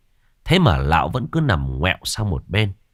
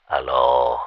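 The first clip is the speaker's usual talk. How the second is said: grumpy sleepy voice; 6 semitones lower than usual. Acted. Groggy Tam answers phone midnight.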